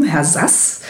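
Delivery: ordinary talk, nothing marked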